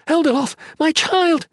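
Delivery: high, frantic cry; anguished yell. A narrator's reading. Mother crying for her missing child, anguished yell. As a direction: yell out for the lost baby. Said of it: "she yelled".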